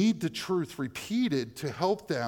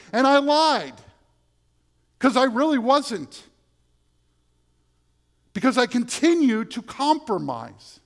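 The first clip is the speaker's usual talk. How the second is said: higher, louder fervent preaching; full-voiced revival preaching; preaching at full force; 8 semitones higher, 8 dB louder than usual. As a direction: drive it home to the congregation.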